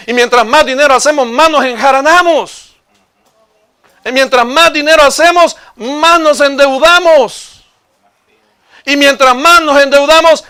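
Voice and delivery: high sermon voice building to loud; full-force preaching. Real, not acted. Preacher decrying the chase for money to the congregation, full-force preaching.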